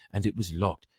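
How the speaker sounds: plain reading voice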